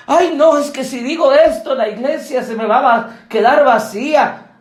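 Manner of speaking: high, whiny mock pastor fretting; mock fearful pastor. Timid pastor fearing an empty church, mock whiny. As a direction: whine like a timid modern pastor. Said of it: "¿Cómo hubiera actuado un pastor de hoy?".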